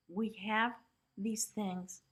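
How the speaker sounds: normal talking voice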